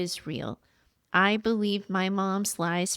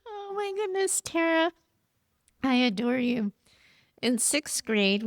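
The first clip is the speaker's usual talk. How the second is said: real high, teary squeak easing into affection; tearfully moved; 6 semitones higher than usual. Real, not acted. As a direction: overcome with emotion, voice pitched way up. Reader overcome by the letter.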